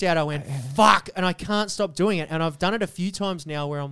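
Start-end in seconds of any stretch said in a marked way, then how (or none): 0.7-1.1 loud, higher retold swear; exasperated outburst, retold; exclaimed curse. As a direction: burst out with it, loud and high. Retelling his exasperated reaction.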